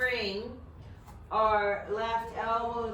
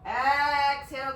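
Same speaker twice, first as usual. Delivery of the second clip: higher, louder, slowly stretched word; drawn-out breath cue; 4 semitones higher, 6 dB louder than usual. Real, not acted. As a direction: sustain it almost sung to pace the breath. Cueing the breath in yoga movement.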